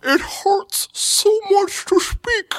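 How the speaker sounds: very high, agonisingly slow pained whine; squashed-face Klingon. Retold or imitated. Joking as a Klingon in a tight mask, squashed and pained. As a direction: drag out each word through a crushing mask. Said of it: "that mask that they have on is just compressing their face".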